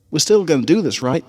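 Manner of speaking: a doubtful little pony teasing her friend; high filly voice. Acted. Scootaloo doubting Apple Bloom's speech, high and skeptical.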